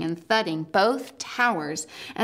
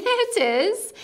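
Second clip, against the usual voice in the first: laughing; 13 semitones higher than usual. A narrator's reading. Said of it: "said Lucy, laughing".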